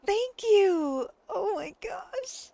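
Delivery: real happy tears; high, tearful thanks; crying. Real, not acted. Overwhelmed by gifts, crying.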